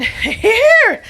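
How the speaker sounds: high, loud burst of discovery; a high shout of revelation; sudden cry. Acted. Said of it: "said I with a sudden cry".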